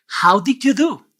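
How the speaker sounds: ordinary talk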